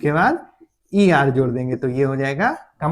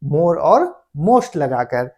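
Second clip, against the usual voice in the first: slow, spelled-out answer; slow, deliberate; much slower than usual. Shown as a demonstration. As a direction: lay out more and most slowly. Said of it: "beautiful का हम लोग कैसे बनाएंगे?".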